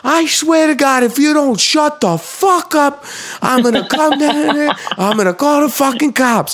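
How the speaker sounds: very high, strained mock yelling; imitated scream. Imitating an enraged man shouting from his window, screaming. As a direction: holler threats like a furious neighbour. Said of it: "this guy screaming across the street".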